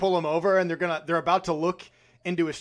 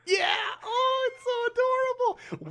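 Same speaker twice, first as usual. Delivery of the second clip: much higher squeal of delight; squealing adorable; gushing. Real, not acted. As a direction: coo over a cute dog. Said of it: "reacting to the dog".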